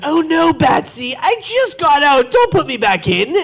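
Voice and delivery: hammed-up caught villain begging Batsy; mock-scared comic book villain; playful villain. Plays villain to Batman fan.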